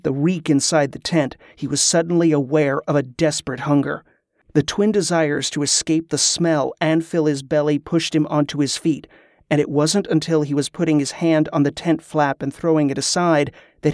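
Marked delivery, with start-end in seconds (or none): none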